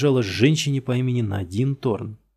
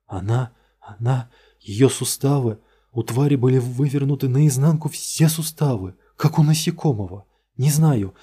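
terrified; 3 semitones higher, 4 dB louder than usual. Acted. Suspect recalling the creature, panicked and stammering. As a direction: stumble over the horror.

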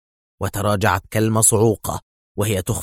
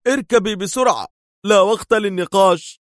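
tense shout; 10 semitones higher, 4 dB louder than usual. A narrator's reading. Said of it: "صاح بها في توتر بالغ".

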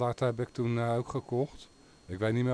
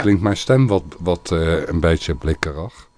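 tinny voice from a distance, mic gain turned up; speaking from afar; 4 semitones lower, 10 dB louder than usual. Shown as a demonstration. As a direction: talk into the mic from further away, loud and a bit tinny. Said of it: "je kan van ver af". (blikkerige stem, van ver af pratend)

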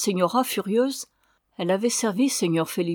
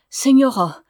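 appel fort; 6 semitones higher, 8 dB louder than usual. Acted. Ramona s'adresse à la señora effrayée.

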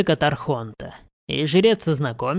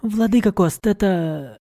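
higher voice, breaking off; young woman voice; 4 semitones higher than usual. A narrator's reading. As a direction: start politely then falter. Mari falters.

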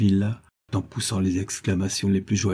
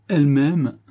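louder, rising joy on the repeat; fervent outburst; 5 semitones higher, 4 dB louder than usual. Acted. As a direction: let the joy burst out the second time. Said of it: "comme en une prière de reconnaissance, de ferveur suprême". (élan de ferveur, plus fort)

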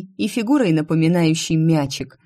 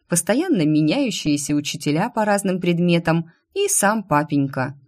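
usual speaking voice

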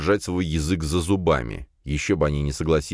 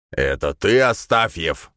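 harsh barking commands; 3 semitones higher, 3 dB louder, slower than usual. A narrator's reading. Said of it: "гаркнул он".

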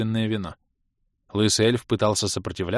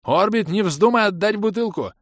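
high outraged yelling; 11 semitones higher, 4 dB louder than usual. A narrator's reading. Bom keeps yelling in outrage.

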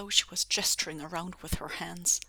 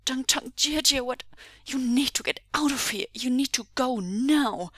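high, loud, pressing warning to leave; urgent plea; 6 semitones higher, 7 dB louder than usual. A narrator's reading. Captive husband urges rescuers to flee, urgent. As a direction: push them to flee now.

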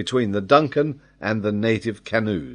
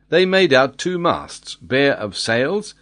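ordinary delivery

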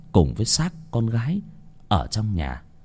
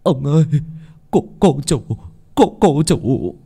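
high, breaking off and repeating; panicked stammer; 4 semitones higher than usual. A narrator's reading. Servant runs to master with news of daughter, panicked stammering. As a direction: stammer out dreadful news in a raised pitch.

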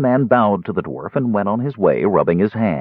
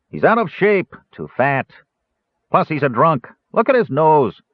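dwarf slave buyer, higher and scornful; gruff dwarf; 7 semitones higher than usual. Acted. Dwarf dismissing Caramon to the dealer, scornful. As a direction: sneer at the prisoner's flaws.

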